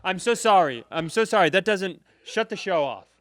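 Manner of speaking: hammed-up alarm, begging to cut the show; mock panic. Panicked plea to producer.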